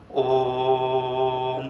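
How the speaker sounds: slow, low, long-held om; drawn-out om. Shown as a demonstration. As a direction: stretch om out like a chant. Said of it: "ज्यादा से आपको उच्चारित करना पड़ेगा ओम".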